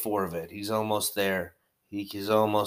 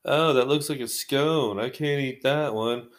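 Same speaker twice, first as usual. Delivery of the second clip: slow, higher put-on fussy English voice; mock English person; 4 semitones higher than usual. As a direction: lightly play a picky Englishman, slowly. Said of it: "English people would probably be like".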